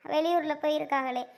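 ordinary talk, nothing marked

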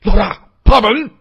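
high, loud desperate scream; anguished, full-force yelling; frantic roar. A narrator's reading. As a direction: roar out to fallen comrade and boss. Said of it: "纳善狂吼道".

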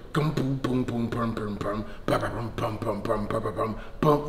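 soft beatboxed booms, fading to near-whisper; beatbox beat. Shown as a demonstration. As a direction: lay down a quiet beat with the mouth. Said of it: "Hit me".

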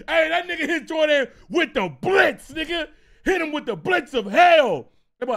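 loud, high, laughing exclamation; hyped storytelling. Real, not acted. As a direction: crow it through laughter. Amused, hyped retelling of brush-off.

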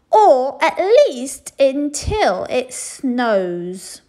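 loud, very slow sulky; stubborn afterthought. A narrator's reading. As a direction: add it defiantly. Princess adds stubbornly.

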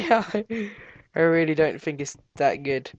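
ordinary delivery

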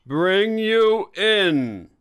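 loud, high, slowly stretched threat; drawn-out shout. Acted. Inspector threatening someone, drawn-out shout.

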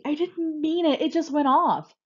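high, loud protest; panicked excuse, pitched well up; defensive yell. A narrator's reading. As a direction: yell back in self-defence. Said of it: "Stormy yells back at Frosty".

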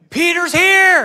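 high, loud blurted announcement; excited shout. Retold or imitated. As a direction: blurt it out, can't contain it. Rhoda telling the praying people.

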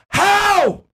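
high-pitched, indignant disbelief; incredulous challenge. Real, not acted. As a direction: throw the question back way up high. Rebutting accusers as an eyewitness.

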